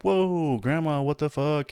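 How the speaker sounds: high, drawn-out exclamation of surprise; mock shocked reaction. Grandson reacting to grandma's sudden F-bomb, mock shocked. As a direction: stretch the opening high, acting stunned by grandma.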